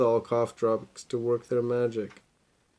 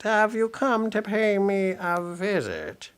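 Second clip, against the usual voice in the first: high, slow squeak; squeaky voice; 8 semitones higher, slower than usual. Acted. Disguised Pete greeting Red, high slow squeak. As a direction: squeak it like a fake granny. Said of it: "he squeaked".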